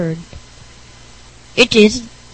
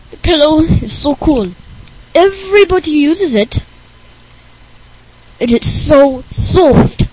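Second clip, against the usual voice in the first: lightly voiced, a bit higher dreamy kid; Rick Berner voice; 5 semitones higher, 5 dB louder than usual. Rick raving about pillows, lightly voiced and dreamy. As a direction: gush over the pillow.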